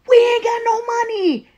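very high, loud, sustained holler; yelling out. Retold or imitated. Brother answering her demand for money, yelling out. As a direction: holler it at the top of your pitch. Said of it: "The brother yells out".

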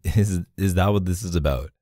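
usual speaking voice

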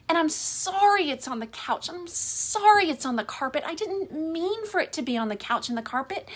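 lightly voiced apologetic wife. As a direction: voice her anxious, rushed apologies. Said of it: "And you're like".

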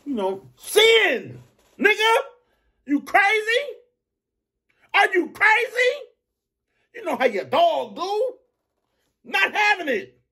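high, slow and drawn-out hype; hyped, high-pitched street rant. Real, not acted. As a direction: work yourself up into an outraged rant. Opening rant, high shifted voice.

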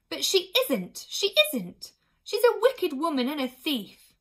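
high, loud, indignant queen; angry outburst. A narrator's reading. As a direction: burst in and denounce her at full voice. Queen angrily interrupts about kidnapper.

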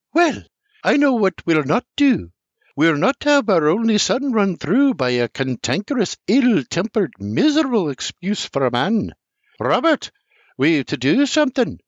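high, indignant mother; mother's voice. A narrator's reading. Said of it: "with a mighty wave of her hand says".

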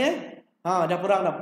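ordinary talk, nothing marked

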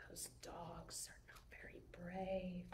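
breathy whispered pity; whispered aside. Real, not acted. Whispered aside during story.

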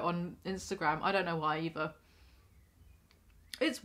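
talking with mouth full; muffled by hand over mouth; chewing behind hand. Real, not acted. Seen 0: Hand covers mouth while talking, food in other hand, stops 2.8.

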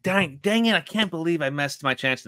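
high-pitched acted frustration; mock exasperated outburst. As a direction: act out a hammed-up "dang it" moment. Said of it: "he's like".

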